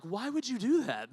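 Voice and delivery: high, drawn-out mock whine; hammed-up incredulous complaint; exasperated protest. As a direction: protest slowly in pained disbelief. Retold exasperated protest to coach.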